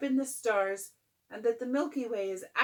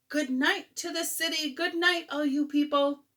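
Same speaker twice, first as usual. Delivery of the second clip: calling out; 7 semitones higher, 7 dB louder than usual. Acted. Calling out from flying machine.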